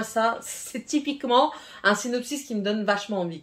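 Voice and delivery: normal talking voice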